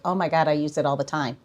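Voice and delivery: normal talking voice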